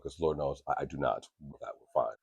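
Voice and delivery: ordinary talk, nothing marked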